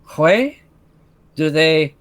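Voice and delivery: comically bad French accent. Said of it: "bad French makes your French look better".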